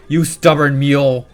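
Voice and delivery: a loud, cranky elder telling her off; angry elder scolding. Acted. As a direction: bellow at the stubborn youngster.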